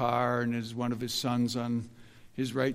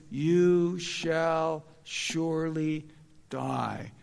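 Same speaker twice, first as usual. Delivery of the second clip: slow, drawn out, every word weighted in you shall surely die; emphatic warning; 2 semitones higher, much slower than usual. Shown as a demonstration. Preacher quoting God's warning to Adam, slow and emphatic. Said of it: "Very, very emphatic".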